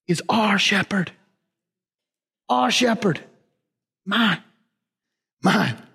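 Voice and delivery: hushed whisper on "is our shepherd"; awed whisper. Real, not acted. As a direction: drop to a reverent breath. Reverent climax.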